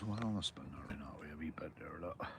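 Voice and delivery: hushed unvoiced whisper to the camera; soft whisper while spinning the camera. Real, not acted. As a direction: whisper so you don't spook the fish. Filming quietly by the water.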